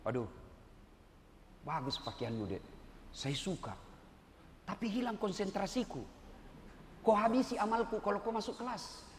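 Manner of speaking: soft, kind scolding of a student; soft gentle teacher reprimand. Retold or imitated. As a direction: tell her off kindly and quietly. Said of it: "Tapi saya tidak kasar ngomong".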